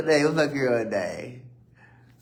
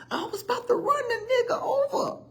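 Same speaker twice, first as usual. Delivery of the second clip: much higher, breaking into laughter; laughing high. Real, not acted. Telling viewers he nearly hit a man, laughing. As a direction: laugh it out in disbelief.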